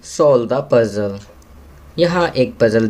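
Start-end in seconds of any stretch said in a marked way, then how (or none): 0.1-1.2 very slow, word-by-word English read aloud; slow classroom reading. Shown as a demonstration. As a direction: read it slowly for young pupils to follow. Reads question slowly. (बच्चों के लिए बहुत धीरे-धीरे अंग्रेज़ी पढ़ना)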